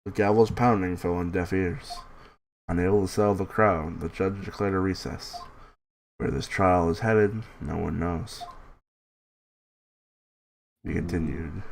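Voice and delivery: quiet, even story narration. A narrator's reading. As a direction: read it like a narrator. Reading game narration.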